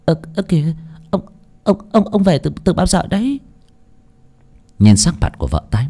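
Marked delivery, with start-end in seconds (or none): 0.1-3.4 high, stammering, caught-out wife; flustered stammer. A narrator's reading. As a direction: stutter it out, startled and guilty. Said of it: "luống cuống". (giọng lắp bắp, hốt hoảng)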